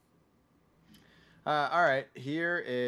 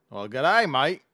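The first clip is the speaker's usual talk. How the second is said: loud, hammed-up Australian accent; mock Australian; 5 semitones higher, 9 dB louder than usual. Hosts riffing on the movie, mock Australian accent.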